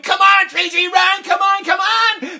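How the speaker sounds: loud high urging call; coach's urging. Retold or imitated. Coach kneeling at home plate urging Tracy on, shouting. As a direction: beg her home with your voice.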